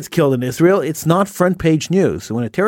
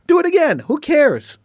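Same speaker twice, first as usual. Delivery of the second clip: much higher, mock-careless shrug; sarcastic indifference; 9 semitones higher than usual. Host voices the community's message to attackers, sarcastic indifference. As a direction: pitch it way up as if nothing matters.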